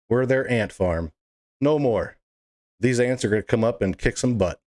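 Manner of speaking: ordinary talk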